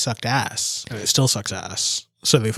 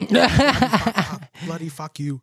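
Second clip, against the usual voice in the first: laughing repeat; 6 semitones higher, slower than usual. Retold or imitated. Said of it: "S0 laughs".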